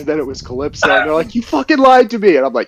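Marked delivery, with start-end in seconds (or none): 1.3-2.4 much higher mock betrayal; imitating readers outraged at the lie; betrayed fan. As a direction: whine it like a cheated reader. Fans acted, betrayed.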